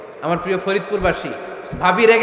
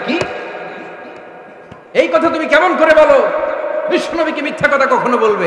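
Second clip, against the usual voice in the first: high, loud acted indignation; angry rebuke, voice raised; furious father; 7 semitones higher, 3 dB louder than usual. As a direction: explode at a daughter in outrage. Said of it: "রেগে মেগে আগুন".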